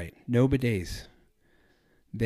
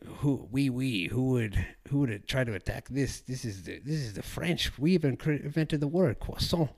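playfully smug French voice, pitched up toward the end; mock arrogant Frenchman; 4 semitones higher than usual. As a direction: lord it over everyone like a proud Frenchman guarding his croissant. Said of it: "It's like".

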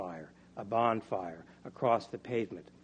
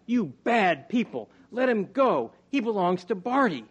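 put-on high, loud, indignant child's shout; 10 semitones higher, 8 dB louder than usual. A narrator's reading. Barty shouts at the mob holding Arthur, shouting. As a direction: yell at the crowd, high and full-voiced. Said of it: "he shouted".

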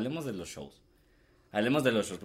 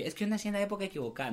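mocking quote; 9 semitones higher, 7 dB quieter, much faster than usual. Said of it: "la mara que dice así".

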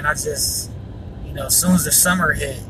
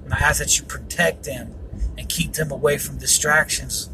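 prayer; 2 semitones lower, 4 dB quieter than usual. Acted. Prays against yawning, pleads blood.

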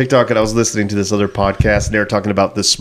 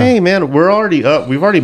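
high, louder joking brag; playful boast, pitched up and louder; excited; 9 semitones higher, 4 dB louder than usual. Real, not acted. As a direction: hype it up. Joking about podcast earnings.

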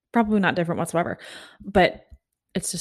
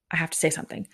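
urgent breathless whisper to herself; frantic inner whisper, barely voiced; panicked whisper; 6 dB quieter, faster than usual. Inner panic facing Paul Rudd.

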